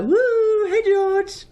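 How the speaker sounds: high, hooting call of woo hey George; mock teasing cheer. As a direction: hoot it up high like teasing a mate.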